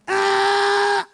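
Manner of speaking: loud, high startled shout; sudden alarm cry. Retold or imitated. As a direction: let out a sharp alarm cry. Inner alarm cry heard in church.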